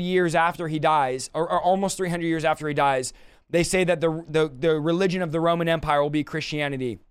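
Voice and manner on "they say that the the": normal talking voice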